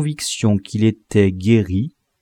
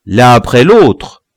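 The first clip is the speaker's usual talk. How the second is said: louder, slow order; shrill command; 8 dB louder than usual. A narrator's reading. Lobie orders the crowd, loud and shrill. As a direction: hold them back one by one. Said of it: "cet accent criard".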